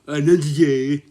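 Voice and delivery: loud, high mock-silly yell; silly outburst. Acting out a silly reply, loud and high.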